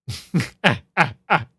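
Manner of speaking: high, loud incredulous laugh; burst of laughter. Real, not acted. Streamer laughing at the new character design, high loud laugh.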